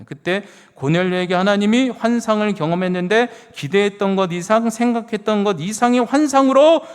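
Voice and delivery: preacher building fervor; building excitement. Acted. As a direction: swell toward the climax.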